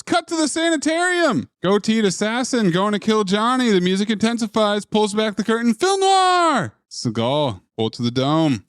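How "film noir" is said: excited announcement. Acted. Recapping the film, big exclamation.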